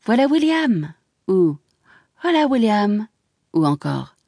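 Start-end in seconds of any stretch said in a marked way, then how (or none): 0.1-0.9 higher, bright greeting for William; clear light voice. A narrator's reading. Isabelle greeting William at the station, higher and light. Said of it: "sa voix claire et légère disait". 2.2-3.1 higher, cheerful hail; a light lifted call of greeting; clear light voice. A narrator's reading. Isabelle hailing William as he arrives, lifted voice. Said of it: "sa voix claire et légère disait".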